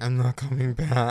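a lightly voiced diva exit line; imitating Paula. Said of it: "where Paula is like".

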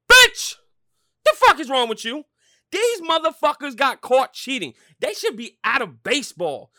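ranting yell at the critic; furious shout. Real, not acted. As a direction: blow up in outrage. Rant about booing the Astros.

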